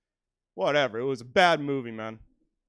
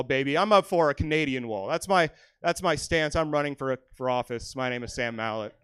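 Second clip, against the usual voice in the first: ordinary delivery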